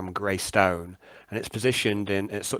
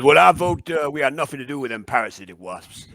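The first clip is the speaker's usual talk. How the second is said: Gumbo; 5 dB louder than usual. Acted. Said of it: "Ogumba says".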